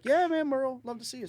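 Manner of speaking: high-pitched, upbeat reassurance; friendly invitation. Retold or imitated. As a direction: usher the guest in, voice lifted high. Retold friendly invitation onto the bus.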